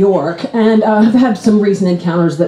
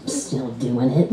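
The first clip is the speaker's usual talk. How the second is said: low, soft, slow condescension; hushed, drawn-out judgmental question; judgmental sneer; 10 semitones lower, 10 dB quieter, slower than usual. Acted. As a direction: murmur it down at a rival. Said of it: "he comes by me with his judgment".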